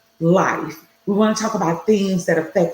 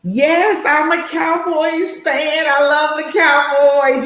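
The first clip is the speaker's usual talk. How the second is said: excited fan; 8 semitones higher, 3 dB louder, slower than usual. Real, not acted.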